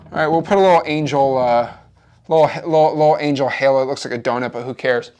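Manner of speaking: ordinary delivery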